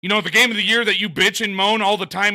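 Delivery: furious shout at full force; angry yelling. Real, not acted. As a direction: yell it in outrage. Furious rant at the other streamer.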